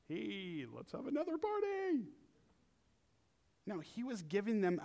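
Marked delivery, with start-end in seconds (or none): 0.1-2.1 much higher hammed-up party call; mock party cheer. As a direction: call for another party like a giddy guest. Guests cheering another party.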